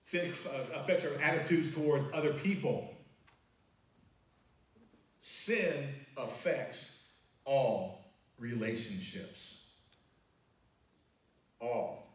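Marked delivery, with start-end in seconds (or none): 5.2-9.6 each word set apart, very slowly; slow, stressed. Shown as a demonstration. Preacher to church, spelling out the key line slowly. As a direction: drive home "Sin affects all relationships" word by word.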